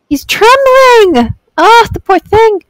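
squeaky, pitying coo to a small creature; high cooing baby talk. Acted. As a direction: coo over it like a frightened pet. Comforting the trembling game creature.

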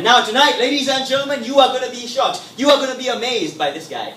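high, rousing stage announcement; showman's hype. Real, not acted. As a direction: promise them wonders, pitched well up. Magician hypes his act to audience.

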